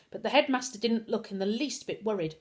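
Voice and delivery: ordinary delivery, nothing marked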